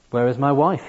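desperate call for his wife; choked cry. A narrator's reading. Dying man crying out for his wife in anguish. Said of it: "he choked".

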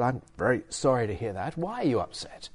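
hushed, apologetic reply in a retold exchange; low-key, concerned retelling; soft apology. Acting his apologetic reply to upset listener.

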